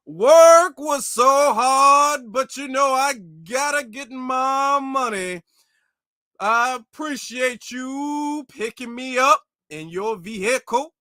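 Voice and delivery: slow, exaggerated proper-talking impression; mock proper voice. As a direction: overdo a stilted, formal way of talking. Mocking an overly proper speaker.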